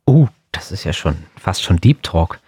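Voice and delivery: ordinary talk, nothing marked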